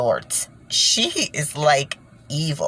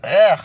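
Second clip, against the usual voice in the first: a loud groan of disgust, a bit higher; 3 semitones higher, 7 dB louder than usual. Real, not acted. Describing her feeling, a loud disgusted groan. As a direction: let the revulsion out in one groan. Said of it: "I just feel like".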